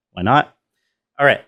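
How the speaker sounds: normal talking voice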